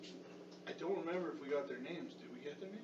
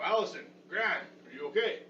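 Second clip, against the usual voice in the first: calling out; 5 semitones higher, 7 dB louder than usual. Acted. Calling through the door.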